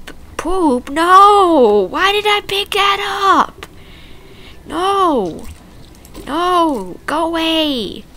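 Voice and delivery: high, loud grossed-out shouts; shrieking ew, no; disgusted yelling. Real, not acted. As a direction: yell it in real disgust. Disgusted at picking up poop.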